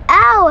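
sharp high ow; pained yelp. Real, not acted. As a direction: yelp out in pain. Hurt in game, yelps.

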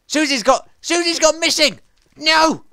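high, loud alarmed outburst; panicked cry. Real, not acted. Gamer cries out as his pet goes missing, panicked. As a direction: shout it out in sudden panic.

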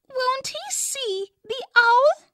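put-on high, slightly rough little girl; a small girl anxious for a rescue; high roughened child voice. A narrator's reading. Jo asking her father anxiously, high and a little rough. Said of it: "in a high and faintly roughened voice".

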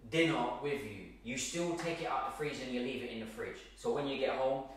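normal talking voice

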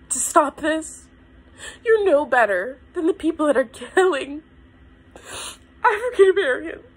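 high, tearful voice breaking with sobs; overwhelmed, crying hard in a very high pitch; crying, real breakdown. Real, not acted. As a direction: push the words out through real tears. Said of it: "I'm getting emotional".

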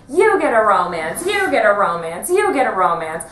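loud Oprah giveaway; Oprah giveaway shout. Retold or imitated. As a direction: belt it like a talk show host. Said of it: "I was like".

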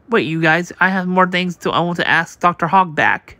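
usual speaking voice

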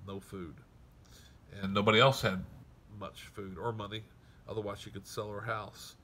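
ordinary talk

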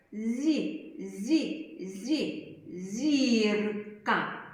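зі with soft palatal z before the word зірка. Shown as a demonstration. Teacher demonstrating soft з in зірка. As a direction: soften з before і, then land on зірка. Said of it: "то він може м'яко вимовлятись".

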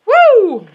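high whooping cheer; triumphant woo. Real, not acted. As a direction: cheer it out loud. Triumphant after unpacking.

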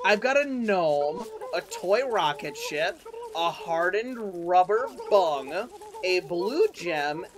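slow, savoring list of loot. Real, not acted. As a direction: read items out slowly. Slowly listing loot.

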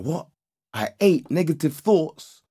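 high-pitched, incredulous retold question; astonished realization. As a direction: question it in disbelief.

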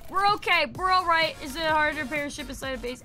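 ordinary talk

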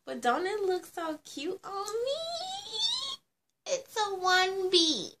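high, slow cutesy squeal; cutesy preening. Pretend. Vlogger showing off her wig to viewers, high cutesy voice. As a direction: gush over yourself cutely.

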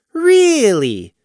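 high, sarcastic drawl. A narrator's reading. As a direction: drawl it out, unimpressed. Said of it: "he drawled".

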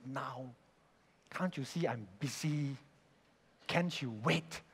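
low, very soft grumble; muttering under breath. Retold or imitated. Employee at the keyboard complaining about the boss, muttering. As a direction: grumble it quietly to yourself. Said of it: "muttering under your breath".

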